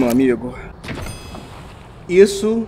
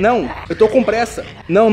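usual speaking voice

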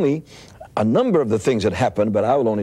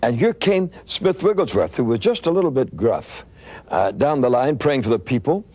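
ordinary talk

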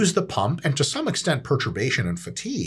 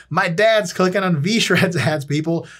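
mock outrage; 6 semitones higher, 4 dB louder, slower than usual. Mock outrage, announcing to viewers.

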